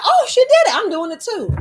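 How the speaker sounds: loud, high burst of excitement; impressed eagerness. Acted. Soldiers seeing their leader do it first, excited. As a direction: exclaim it as if newly inspired. Voices soldiers following her example.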